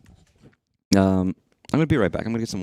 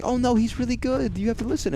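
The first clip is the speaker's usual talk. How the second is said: high, pleading voice, much higher; mock pleading entertainer. Entertainer begging crying children, in a high mock plead. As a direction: pretend to beg the kids to listen. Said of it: "They'd be like".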